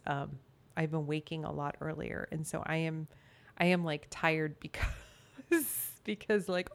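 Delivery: ordinary talk, nothing marked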